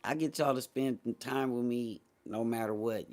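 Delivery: usual speaking voice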